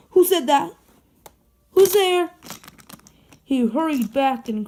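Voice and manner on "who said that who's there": high, loud frightened questions; scared shout. Acted. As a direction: call out into the dark in fear. Frightened by unseen laugh.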